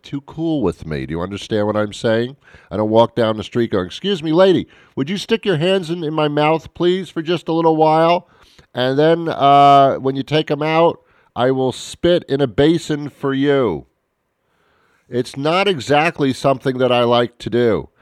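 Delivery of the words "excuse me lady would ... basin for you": high pleading mock request. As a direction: beg sweetly, voice raised. Imagined request to hygienist.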